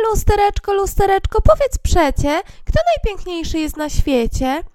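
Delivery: high, sweet-voiced queen with a smile; queen asking smiling. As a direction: coax the mirror like a vain queen. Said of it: "zapytała z uśmiechem". (królowa pyta z uśmiechem, wysoko)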